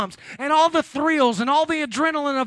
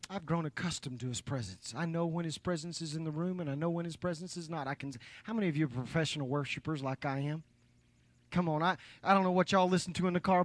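much softer, personal and confiding; quiet confession; 5 semitones lower, 10 dB quieter than usual. Real, not acted. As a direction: confess it softly, as if to a friend.

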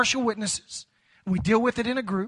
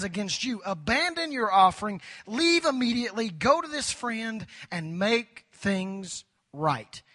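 strong, louder scripture read; 4 dB louder than usual. Acted. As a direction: proclaim the text to the congregation. Reading scripture forcefully.

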